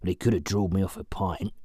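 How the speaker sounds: lightly voiced grumbling old man; a hint of a grumpy old drinker. A narrator's reading. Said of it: "grumbled the old man".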